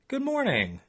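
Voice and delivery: lightly voiced Holly; Holly's voice. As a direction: greet him like a cheerful older lady. Holly answers the narrator.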